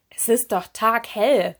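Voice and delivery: slow, loud, emphatic correction; incredulous. A narrator's reading. Lanternfish contradicting lost Serena, incredulous. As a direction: state the obvious with loud disbelief.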